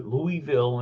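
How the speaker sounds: "Louisville" as LOO-ee-vil; textbook mispronunciation. Shown as a demonstration. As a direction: say "Louisville" in three clear syllables, LOO-ee-vil. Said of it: "that's wrong".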